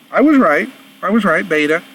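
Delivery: high-pitched, pleased outburst at being proved right; triumphant. Real, not acted. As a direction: crow it high, vindicated by the check. Guess confirmed after looking it up.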